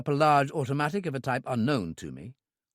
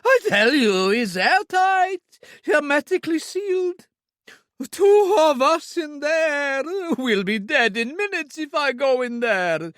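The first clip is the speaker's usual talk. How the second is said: much higher, loud frantic begging; panicked pleading; 14 semitones higher, 7 dB louder, slower than usual. A narrator's reading. Owner begs not to be locked in the safe, high panicked pleading. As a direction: beg for your life.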